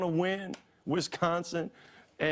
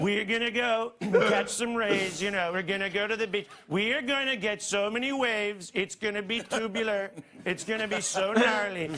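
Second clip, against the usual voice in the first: California surfer; 2 semitones higher than usual. Retold or imitated. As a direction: play a chill beach surfer. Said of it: "he sounds like a California surfer".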